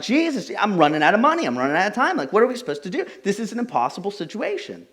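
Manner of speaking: anxious prayer, running out of money; desperate retold prayer. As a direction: plead with rising panic.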